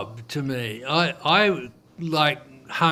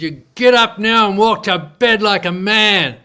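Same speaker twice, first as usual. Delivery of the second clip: loud, higher, commanding wife's voice; stern command; 5 semitones higher, 8 dB louder than usual. Retold or imitated. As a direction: bark the order at him to get up off the floor. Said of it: "scared the crap out of me".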